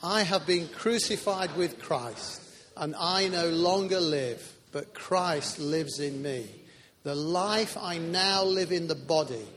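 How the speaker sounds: slow, loud, measured declaration; leading congregational recitation. Real, not acted. Preacher leading the church in saying the verse, slow and emphatic. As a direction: lead a crowd reciting scripture together. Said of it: "Let's say it, please".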